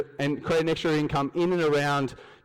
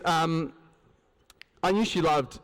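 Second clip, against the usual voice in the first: ordinary delivery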